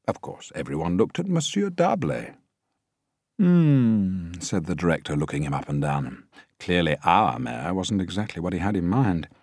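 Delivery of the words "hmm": louder, higher hmm; doubtful hum. A narrator's reading. Director weighs the mayor.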